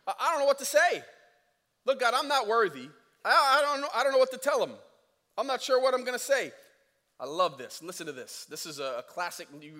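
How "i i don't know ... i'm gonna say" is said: high, loud flustered; nervous excuse. Retold or imitated. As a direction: stammer it, panicking. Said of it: "Excuse number two".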